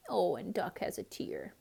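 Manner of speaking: ordinary delivery, nothing marked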